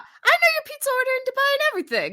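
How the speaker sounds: high, fast, hammed-up British voice; British accent. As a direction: play a cheeky Londoner, quickly. Mocking UK Brian.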